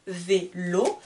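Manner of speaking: "Vélo" in two separate syllables, rising at the end; cut into syllables. Shown as a demonstration. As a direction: split "Vélo", lifting the second syllable. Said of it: "Il y a deux syllabes". (« Vélo » en deux syllabes, finale montante)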